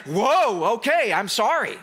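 loud startled whoa, then a laughing apology; like a scolded kid backing off fast; startled sorry. Pretend. The storm startled by its creator's voice, apologizing.

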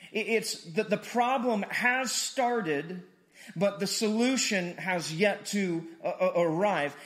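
slow, loud, emphatic preaching; slow preaching climax. Acted. Pastor tells the church about Saturday, slow and loud. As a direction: land each phrase slowly and hard.

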